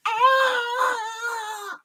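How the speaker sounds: answering high-pitched squeal; imitated high scream. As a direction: squeal back as high as the voice will go. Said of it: "can't get quite as high a voice as they did".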